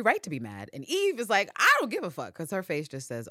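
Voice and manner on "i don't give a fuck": defiant. Retold or imitated. Eve dismissing Constantine.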